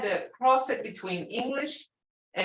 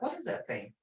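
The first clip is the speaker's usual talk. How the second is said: ordinary talk, nothing marked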